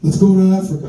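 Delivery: loud [name] imitation.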